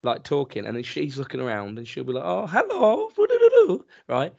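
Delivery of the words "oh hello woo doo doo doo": high, loud cooing mimic of the wife; excited wife. Husband imitating his wife cooing at children, high and loud. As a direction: coo at the kids like an over-excited mum. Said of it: "She's well excited".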